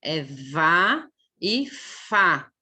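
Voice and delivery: "vá e fá" very slow, fricatives held; phonics demo. Shown as a demonstration. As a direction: drag out "vá e fá" for the class. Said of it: "é tudo fricativo". ("vá e fá" bem lento)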